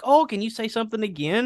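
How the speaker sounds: high, eager asking voice; mock curious outsider. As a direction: squeak it like an eager tourist. Imitating Californians asking.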